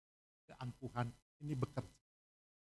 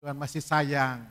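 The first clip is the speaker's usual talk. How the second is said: preaching at full force; 13 dB louder than usual. Acted. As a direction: stretch each phrase out to the crowd. Preacher exhorts, slow and loud.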